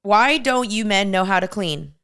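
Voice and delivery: ordinary delivery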